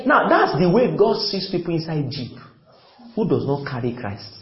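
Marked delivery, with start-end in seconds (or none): none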